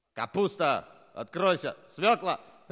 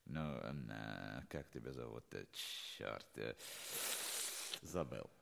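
muttering; 22 dB quieter than usual. Acted. Alone, forgot the password.

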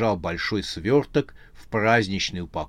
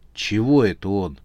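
plain reading voice

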